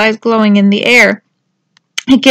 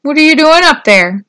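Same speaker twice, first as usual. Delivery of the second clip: raised, higher calling voice; 4 semitones higher, 5 dB louder than usual. A narrator's reading. Turtles below to Tudley on the rock, calling up. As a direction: call up to someone high above. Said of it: "called a voice from below".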